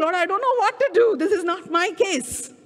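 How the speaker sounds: high, urgent, rushed appeal to God; distressed prayer. Retold or imitated. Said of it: "So I prayed".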